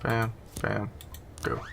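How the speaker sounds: ordinary talk, nothing marked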